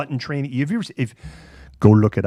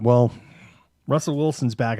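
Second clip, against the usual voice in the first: ordinary talk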